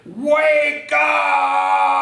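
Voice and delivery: very high, loud shout; yell. Acted. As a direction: bellow it to wake her. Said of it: "yelled at Amy as loud as she could".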